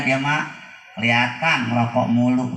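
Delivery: ordinary delivery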